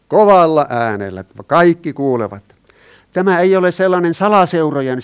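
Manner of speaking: loud, emphatic proclamation. Shown as a demonstration. Preacher stressing that all must hear, loud voice. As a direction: project it so everyone hears.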